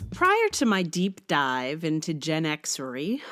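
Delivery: usual speaking voice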